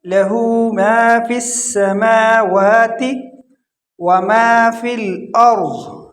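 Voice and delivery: slow recitation, long aa's in السماوات; stretched madd. Shown as a demonstration. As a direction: hold each ما and the vowels of السماوات. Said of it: "ایک الف کے برابر". (مد کھینچ کر تلاوت)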